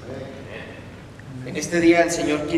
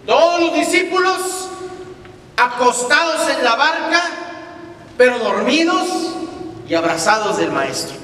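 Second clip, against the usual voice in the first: preaching at full force; 10 semitones higher, 3 dB louder than usual. Real, not acted. Preacher proclaiming to the church that Jesus calms storms. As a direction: drive the promise home to the congregation.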